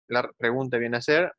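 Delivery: ordinary delivery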